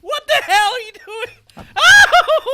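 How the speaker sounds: pained yelling. Real, not acted. Burned hand on hot dog.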